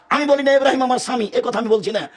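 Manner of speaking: usual speaking voice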